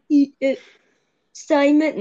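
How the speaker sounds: high, halting Northern Irish go; attempted Northern Irish accent. Mimicking a Girls Aloud singer for friends, Northern Irish accent. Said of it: "Like, she's like".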